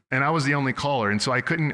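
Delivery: normal talking voice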